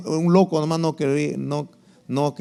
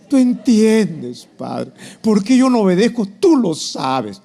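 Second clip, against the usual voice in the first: mock anguished plea, drawn out and swelling; hammed-up self-justifying believer; excuse-making prayer; 5 semitones higher, 5 dB louder, slower than usual. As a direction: plead loudly with God, stretching key words. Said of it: "cambia esa oración de excusas".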